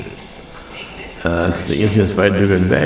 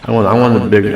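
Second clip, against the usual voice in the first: usual speaking voice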